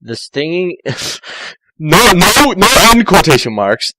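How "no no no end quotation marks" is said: amused correction. Real, not acted. Reader correcting herself, amused.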